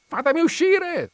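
very high, loud shout; narrator's full-voiced cry of fury; furious yell. A narrator's reading. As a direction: yell like an enraged cat in a trap.

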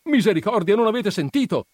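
high, loud cry of alarm; alarmed retort. A narrator's reading. Perpetua wriggling free from Agnese, alarmed retort. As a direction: throw it back in agitation. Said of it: "replicò quella svincolandosi".

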